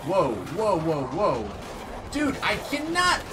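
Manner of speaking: loud panicked whoas; freaked out and yelling; adrenaline rush. Real, not acted. Fleeing the monster.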